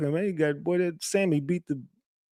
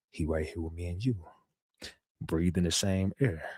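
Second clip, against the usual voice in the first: much lower and softer, quiet menace; imitating Govan low; 8 semitones lower, 10 dB quieter than usual. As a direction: mimic his flat, low hint of a threat.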